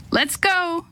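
high, drawn-out call; yell. A narrator's reading. Jake yells to the group to go, calling out. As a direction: yell out to round everyone up. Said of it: "Jake yelled".

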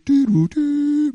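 high-pitched, drawn-out computer doo-doo-doo; sing-song old-computer downloading noise; download noise imitation.

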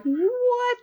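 very high, drawn-out what; stunned high-pitched reaction; shocked disbelief. Retold or imitated. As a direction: squeal it softly in mock disbelief. Reaction to unexpected kiss.

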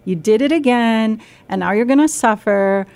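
loud, mocking, scolding voice; harsh inner critic. Inner critic scolding self after dessert, mocking and loud. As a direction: berate yourself for eating dessert. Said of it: "If that voice is like".

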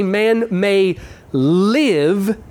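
live drawn out long and slow; stress on live. Shown as a demonstration. Preacher to congregation, stretching live slowly for emphasis. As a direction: lean hard on the word live, stretching it out. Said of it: "watch the emphasis on the word live".

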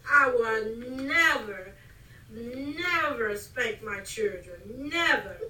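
slow, stretched "never" with pauses after it; emphatic vow. Retold or imitated. Mother recalling her own vow about spanking, emphatic. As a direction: draw out every "never" like a solemn oath.

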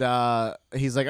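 ordinary talk, nothing marked

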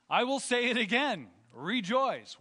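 loud, rising preacher's call; preaching at full force. Acted. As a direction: proclaim it to the congregation.

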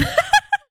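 real high-pitched cackling laugh; high cackle. Real, not acted. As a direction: let out a sudden high cackle. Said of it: "there's the cackle".

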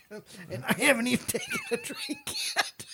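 very high laugh-choked words; wheezing high giggle; squealing laughter. Real, not acted. Laughing fit continues.

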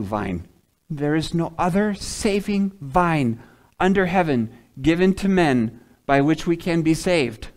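usual speaking voice

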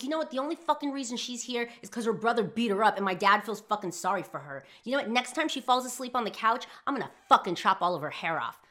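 loud, high furious rant; retold angry yelling. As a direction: reenact blowing up in fury, hammering the swear words. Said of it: "just started yelling".

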